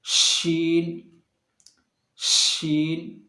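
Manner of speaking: शीन stretched long, the sh hissed out slowly; slow drawn-out sheen. Shown as a demonstration. Teacher demonstrating the letter, very slow. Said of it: "इस तरीके से आप निकालें".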